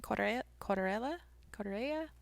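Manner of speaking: trying on a Mexican accent for the place name; mock Mexican accent. Shown as a demonstration. Podcaster tries the place name to listeners, playful Mexican accent. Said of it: "I feel like I have to say that with a Mexican accent".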